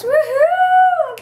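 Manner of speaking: high, loud woohoo of joy; excited cheer. Real, not acted. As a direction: cheer it out, thrilled. Cheering about tickets.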